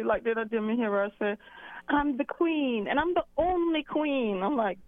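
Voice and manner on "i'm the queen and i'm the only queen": higher, haughty mock queen voice; a radio host's haughty queen boast, mimicked; mocking lofty tone. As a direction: put on airs like a self-crowned queen. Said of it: "she thinks she's so lofty".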